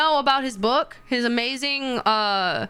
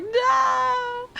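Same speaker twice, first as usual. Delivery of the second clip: high crying "No"; mock wail; 12 semitones higher than usual. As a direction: wail it like a hurt fan.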